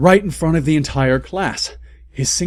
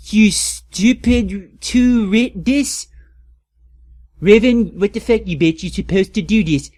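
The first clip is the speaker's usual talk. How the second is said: mock rant; 6 semitones higher, 3 dB louder than usual. Author's note ranted aloud.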